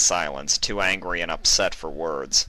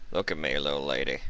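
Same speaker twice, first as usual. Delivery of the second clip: put-on low old stallion voice; 4 semitones lower, 6 dB quieter than usual.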